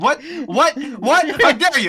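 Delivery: high, loud fake indignation; mock outrage. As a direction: act scandalized over a joke.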